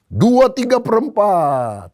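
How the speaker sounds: high, loud, stretched-out numbers; general's drawn-out count. Acted. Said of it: "lalu dia mulai hitung". (hitungan lantang yang diulur-ulur)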